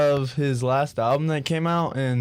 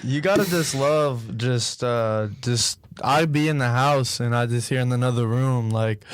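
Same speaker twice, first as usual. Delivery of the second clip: ordinary delivery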